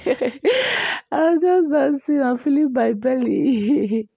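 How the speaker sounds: laughing. Real, not acted. Laughing over a pregnancy clip.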